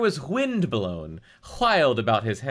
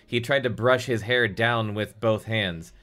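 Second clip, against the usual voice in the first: normal talking voice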